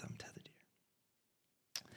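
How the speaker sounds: a slow, unvoiced whisper to himself; hushed private aside. Real, not acted. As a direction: mutter it under your breath while fumbling with the mic. Aside while fixing the headset.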